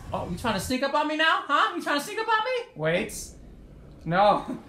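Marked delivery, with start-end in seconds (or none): 0.1-2.7 quick taunt climbing in pitch; rapid, rising needling question; mock-indignant taunt. Real, not acted. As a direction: go higher with each repeated question. Taunting a sneaking opponent.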